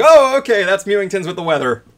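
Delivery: high, loud startled "oh", settling down; mock startled reaction. Retelling a streamer grabbed by his cat, mock startled. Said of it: "and he's like".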